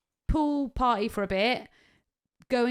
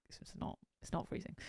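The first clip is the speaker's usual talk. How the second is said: very soft, whispered reply; barely audible, retold; hushed contradiction; 15 dB quieter than usual. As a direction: imitate a whispered correction. Partner gently contradicting her.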